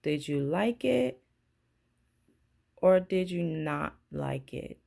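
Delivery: usual speaking voice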